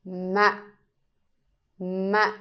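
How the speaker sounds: ma short and low, cut off; nặng tone on ma, brief and low; Vietnamese heavy tone. Shown as a demonstration. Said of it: "make it short".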